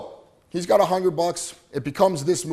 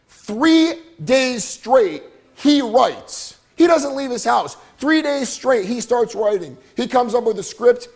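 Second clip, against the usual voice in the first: rising excitement; 6 semitones higher, 6 dB louder, slower than usual. Real, not acted. Motivational speaker builds to climax.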